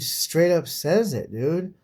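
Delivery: usual speaking voice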